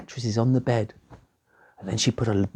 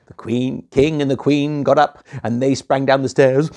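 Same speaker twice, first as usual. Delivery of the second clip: plain reading voice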